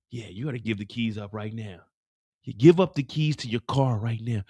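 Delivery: lightly voiced low hitman; low menacing hitman. Hitman voice confronting Mireya.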